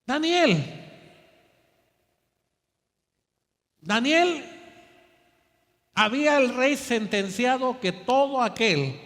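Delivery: fuerte y alto, predicando con fuerza; predicación a plena voz. Real, not acted. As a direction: proclama el relato al templo. (predicador con toda la voz)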